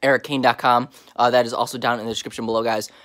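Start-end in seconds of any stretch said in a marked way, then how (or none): 0.0-0.9 mouth full. Real, not acted. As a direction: still chewing a chip. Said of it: "I'm still trying to chew this chip".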